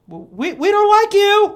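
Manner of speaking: high, loud, stammered imitation; mock bully yell. Bullies repeating their only reason, high mocking yell. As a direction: shout it as a feeble-reasoned bully. Mimics the bullies' weak motive.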